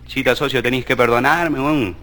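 a weak, fading voice from a deathbed; dying Chilean. Acted. Dying man confessing to his partner, low and weak.

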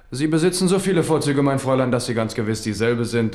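dazzled and flattering; gallant enthusiasm. Acted.

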